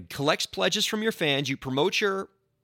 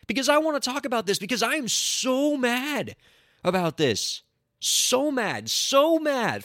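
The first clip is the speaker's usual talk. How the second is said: indignant; 10 semitones higher than usual. Real, not acted. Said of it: "I'm gonna get indignant this week".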